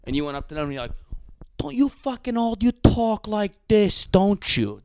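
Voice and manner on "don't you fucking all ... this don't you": drawn-out caricature of Italian-American talk; mock Italian-American accent. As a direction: mimic an Italian-American neighborhood voice, pitched up. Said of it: "which is, like, an Italian neighborhood".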